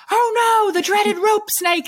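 high, hammed-up TV showman alarm; mock panic like a wildlife host; Steve Irwin. Imitating the TV host facing a rope, mock alarm. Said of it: "and he's like".

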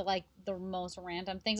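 ordinary talk

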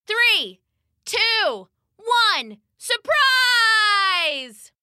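high, shouted surprise countdown; excited countdown. Acted. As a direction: build to a big surprise yell.

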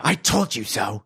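snooty repeat of the line; a haughty old woman's told-you-so; sniffy woman. Acted. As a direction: sniff it out, smug and put-on. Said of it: "The woman sniffed".

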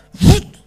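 high loud burst "pfoot"; sharp pop effect. Retold or imitated. Preacher voicing the slab coming up, loud pop. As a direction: spit out the sound of the lift.

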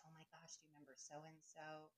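very soft imitated aside; hushed quote. Quoting someone's gossipy question.

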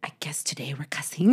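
unvoiced mischievous whisper; whispered kid. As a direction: whisper it like a toddler. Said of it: "and they're like".

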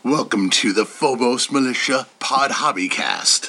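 very low brooding hero voice; deep Batman voice. Shown as a demonstration. Recording a podcast intro as a deep Batman. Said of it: "deep Batman voice".

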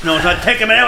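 ordinary talk

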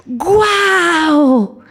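high, slow, delighted exclamation; admiring wow. Retold or imitated. Coworker praising the child's mural, high and delighted. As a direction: gush over the drawing like it's a masterpiece. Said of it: "llega y le dice".

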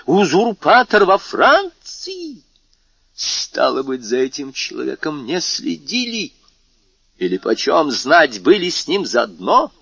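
the king rages at his ministers in alarm; angry outcry. Acted. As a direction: rage and fear at the usurper's return, easing toward suspicion. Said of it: "закричал он".

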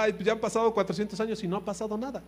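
high, hurried scoffing; mock dismissive complaint. People doubting an old prophecy, impatient mock complaint. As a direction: rattle it off as a doubting crowd.

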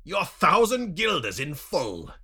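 loud, high, drawn-out taunt; mocking, high-pitched handover. A narrator's reading. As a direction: taunt him loudly with the reward. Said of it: "taunting me with a pouch of gold coins".

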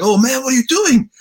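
high, loud Italian exasperation; Antonio's Italian voice. Retold or imitated. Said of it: "he's like".

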